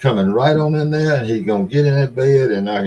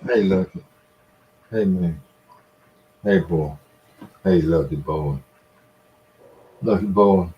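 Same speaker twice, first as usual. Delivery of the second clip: softer, lower coaxing to a cat; talking to a pet; 4 semitones lower, 4 dB quieter than usual. Real, not acted. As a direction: gently coax the cat, low and soft.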